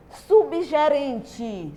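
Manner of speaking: "Subgerente" stretched slowly, sound by sound; drawn-out word. Shown as a demonstration. Teacher introduces a new word to pupils, drawn out slowly. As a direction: draw out "Subgerente" for the class.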